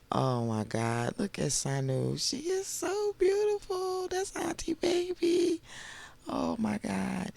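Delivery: high-pitched, drawn-out cooing; slow, soft squeal of adoration; adoring gush. Real, not acted. Gushing over Sanu's looks.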